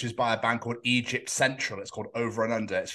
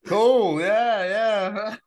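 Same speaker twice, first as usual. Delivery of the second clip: mock forced cheer; 9 semitones higher, 5 dB louder, much slower than usual. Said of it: "he was there going".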